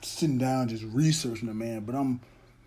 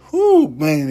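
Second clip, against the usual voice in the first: big, loud, higher-pitched whew; awed exclamation; 4 semitones higher, 15 dB louder than usual. Real, not acted. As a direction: burst out loud, blown away by the music. Awed by a favorite song's verse.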